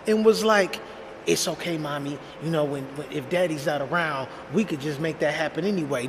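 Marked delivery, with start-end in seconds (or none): 1.3-6.1 put-on soft, low-key voice of a child confiding; hushed retelling of a kid's secret, still voiced; imitated whisper. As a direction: murmur it like a son plotting with mommy. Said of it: "he whispers to my second wife".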